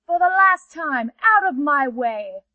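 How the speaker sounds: loud, slowing on "out of my way"; the last warning before attack; she-panther's final loud warning to the rays. Acted. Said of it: "said the she-panther".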